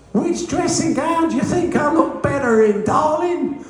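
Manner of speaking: a giant asking his wife about outfits, squeaking; Joe Bugner's squeaky voice. Retold or imitated. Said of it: "he had a very high-pitched voice".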